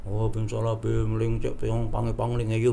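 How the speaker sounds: lightly voiced shaman muttering a spell; half-hearted mock incantation; a hint of a mumbling shaman; mock shaman's mumble. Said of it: "dukunnya ngomel tuh".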